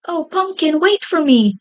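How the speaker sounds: high pleading cry. A narrator's reading. Captured Potato crying after Pumpkin to wait. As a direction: call after the leader, tearful. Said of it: "he cried".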